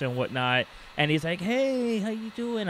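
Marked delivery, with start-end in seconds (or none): 1.4-2.7 high, sing-out "hey"; put-on bubbly hello, imitating him; bubbly greeting. Husband greeting his neighbor outside, all bubbly. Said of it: "he's acting all bubbly".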